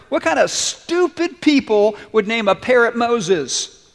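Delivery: mocking. Burglar mocks the parrot's name.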